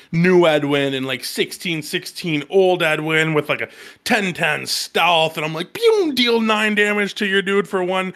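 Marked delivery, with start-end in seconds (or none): 5.7-8.1 excited boast. Retold or imitated. Gleeful boast about a big combo.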